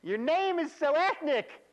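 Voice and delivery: loud, slow, sky-high exaggerated accent; put-on coworker accent. Trainer repeating coworker's remark for laughs, exaggerated put-on accent. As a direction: ham up the coworker, shrieky high and stretched out. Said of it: "And I add the accent".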